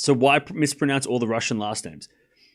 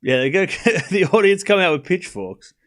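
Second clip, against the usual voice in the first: laughing; 5 semitones higher, 4 dB louder than usual. Real, not acted. Joking about angry audience.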